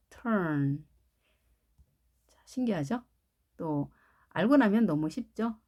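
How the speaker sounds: ordinary talk, nothing marked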